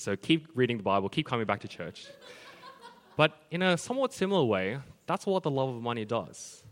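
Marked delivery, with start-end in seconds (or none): none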